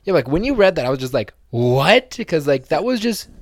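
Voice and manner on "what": much louder disbelieving; loud stunned what; shocked what. Retold or imitated. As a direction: blurt out in disbelief. Shocked reading the text.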